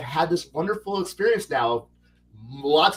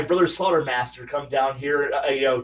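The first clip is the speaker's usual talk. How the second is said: normal talking voice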